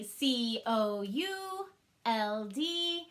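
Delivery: C O U L D spelled out slowly, one letter at a time; slow letter spelling. Shown as a demonstration. Teacher spelling could aloud for the class. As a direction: tap each letter out slowly. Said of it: "arm tap it".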